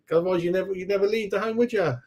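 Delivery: high mocking needle at a friend who never goes out; playful teasing. Real, not acted. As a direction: tease him like a homebody pal. Teasing a chat viewer.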